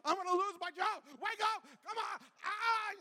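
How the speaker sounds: high, loud re-enacted panic; frantic shouting. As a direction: yell desperately to rouse someone unconscious. Frantically waking the passed-out kid.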